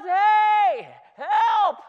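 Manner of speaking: high, loud call from a rooftop; yelling for help. Retold or imitated. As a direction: shout down to the street for help. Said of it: "he yells".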